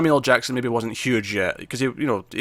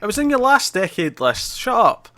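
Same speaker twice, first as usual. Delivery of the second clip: mock outrage; 10 semitones higher than usual. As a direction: tease him with fake indignation. Teasing co-host about his list.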